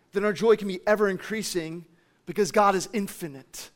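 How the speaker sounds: ordinary delivery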